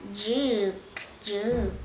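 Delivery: soft low "yu" twice; letter sound yu. Shown as a demonstration. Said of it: "La Y suena". (sonido yu suave y bajo)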